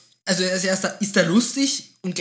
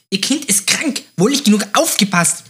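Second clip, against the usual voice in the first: zickige ältere Dame, höher und lauter; zickig; 5 semitones higher, 3 dB louder than usual. Acted. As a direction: keif es als zickige Dame im Bus. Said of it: "sagt zickig". (zickig vorgetragener Vorwurf)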